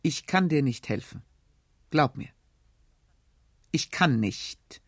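a touch of muffled despair, slowing on the last words; heavy and resigned, understated; dull despair. Acted. Uncle refusing his nephew the money, in muted despair. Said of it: "Und wie in dumpfer Verzweiflung".

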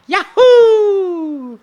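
high, loud, long joyful "Yahoo"; Mario yell. Retold or imitated. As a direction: leap with a Mario whoop. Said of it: "live my life going".

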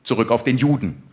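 usual speaking voice

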